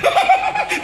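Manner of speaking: high burst of held-in laughter; released laughter. Retold or imitated. Wife laughs freely once outside, high burst. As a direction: finally let the laugh out.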